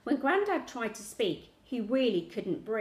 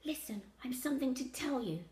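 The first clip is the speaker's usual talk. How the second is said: hushed and higher, softer; nervous whisper; 4 semitones higher, 5 dB quieter than usual. A narrator's reading. Child confiding in sick grandad, nervous whisper. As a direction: confide it nervously under the breath. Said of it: "dropped my voice to a nervous whisper".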